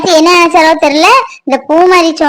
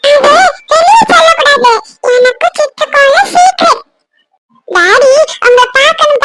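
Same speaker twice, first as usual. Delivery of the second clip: put-on little girl voice; 7 semitones lower than usual. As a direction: talk like a sweet little girl telling daddy secrets. Adult plays little daughter.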